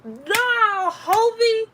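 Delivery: a high, loud put-on voice for a cocky dude; mock tough-guy voice. Storyteller voicing a cocky guy, high mock voice. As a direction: ham it up like a street guy talking big.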